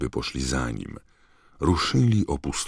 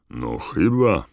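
musing, higher and louder reply; pensive; 5 semitones higher, 6 dB louder, slower than usual. A narrator's reading. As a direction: answer as if thinking it over. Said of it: "odrzekł z zadumą". (z zadumą)

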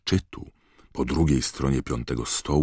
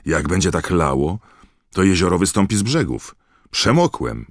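louder, higher-pitched grumble; complaining; 5 semitones higher, 6 dB louder than usual. A narrator's reading. Ron shakes off rainwater, complaining loudly. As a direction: complain loudly about being soaked.

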